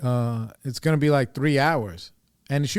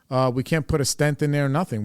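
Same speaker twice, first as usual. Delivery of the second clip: ordinary talk, nothing marked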